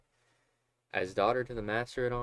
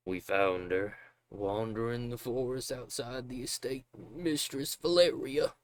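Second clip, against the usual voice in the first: slurring father voice; 3 semitones higher, slower than usual. Acted. Voicing father.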